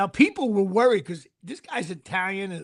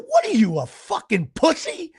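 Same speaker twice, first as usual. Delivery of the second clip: raised-voice retold insult, stretched out; retold loud taunt; 4 semitones higher, 6 dB louder than usual. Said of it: "called someone a pussy really loud".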